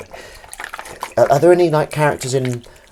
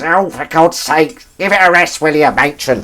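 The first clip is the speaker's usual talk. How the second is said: Kenneth Williams impression; 6 semitones higher, 5 dB louder than usual. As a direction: drag out the camp outrage at matron. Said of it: "He goes".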